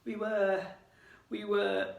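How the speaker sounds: nervous, halting stammer; frightened boy stammering an excuse. Acted. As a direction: stumble over the words, caught out. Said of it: "Ron stammered".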